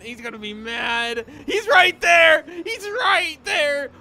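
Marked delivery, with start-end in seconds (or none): 1.4-3.9 high panicked shout. Real, not acted. Gamer spotting the pursuer, panicked shout. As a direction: scream the warning.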